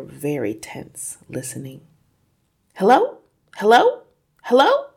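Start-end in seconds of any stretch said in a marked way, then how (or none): 2.7-4.9 high, loud hellos, each rising; mysterious calling voice. A narrator's reading. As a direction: call questioningly from another room. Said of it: "came the voice again".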